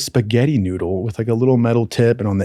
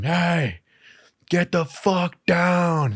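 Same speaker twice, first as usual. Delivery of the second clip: retold yell; 6 semitones higher, 4 dB quieter, much slower than usual. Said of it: "yelling at me from the bottom".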